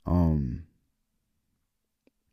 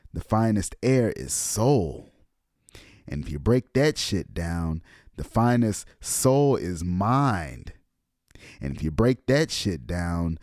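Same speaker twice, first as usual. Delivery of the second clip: each line drawn out slowly; "finest" leaned on; slow emphatic reading; slower than usual. Shown as a demonstration. Hammer each step home slowly. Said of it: "just so you can get this shit one more time".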